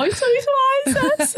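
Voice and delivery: lachend, hoch und langsam; lachend nachgespielt. Retold or imitated. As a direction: vor Lachen kaum ernst. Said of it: "Ich habe so". (eigene Verzweiflung lachend nachgemacht, viel höher)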